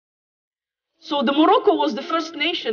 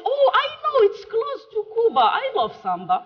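high, gushing mock American voice, far up; mock clueless American; 11 semitones higher than usual. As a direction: send up the clueless tourist in a high chirpy voice.

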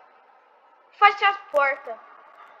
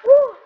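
sudden high startled shout; 10 semitones higher, 11 dB louder than usual. Real, not acted. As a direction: shout at the jumpscare. Jumpscare shout.

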